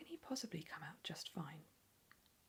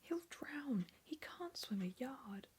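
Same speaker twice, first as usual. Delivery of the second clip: panicked whisper; 7 semitones higher, slower than usual. Acted. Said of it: "screamed Missus Gloop".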